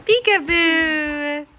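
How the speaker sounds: slow, loud peekaboo; drawn-out peekaboo. Pretend. Mother calling peekaboo to baby, slow and loud. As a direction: stretch the word out for the baby.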